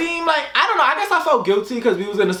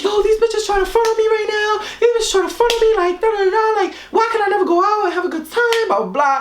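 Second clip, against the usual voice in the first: mock rant; 8 semitones higher, faster than usual. Imitating her phone rant.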